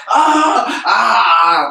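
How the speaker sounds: hammed-up cry of pain; mock scream. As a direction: imitate a woman in labor yelling. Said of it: "they screaming out".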